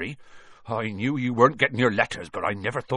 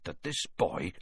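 soft, controlled growl; 3 semitones lower, 7 dB quieter than usual. A narrator's reading. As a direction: keep the anger low and quiet. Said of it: "he growled at the Dursleys".

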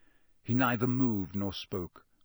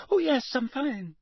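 very high gabble; unnaturally high; 14 semitones higher than usual. A narrator's reading. Shaken boy reassures his friend, unnaturally high. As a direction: insist too brightly that nothing is wrong. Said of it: "gabbled in the same unnaturally high voice".

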